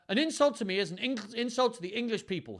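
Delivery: a touch of a sharp royal snap; young king snapping, mildly; snapped. Acted. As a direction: snap back at the earl. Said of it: "Henry snapped".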